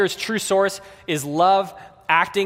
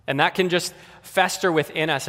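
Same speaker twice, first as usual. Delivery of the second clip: ordinary delivery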